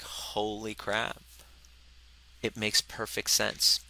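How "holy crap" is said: slow, hushed, stunned under the breath. Retold or imitated. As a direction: let it fall out softly in disbelief. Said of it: "you're like".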